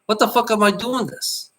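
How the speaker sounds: high-pitched, exasperated retelling of anger; retold anger. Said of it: "then I became angry".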